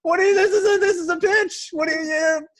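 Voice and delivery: high, loud laughing protest; incredulous laughing outburst; laughing disbelief. Real, not acted. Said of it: "Armie Hammer".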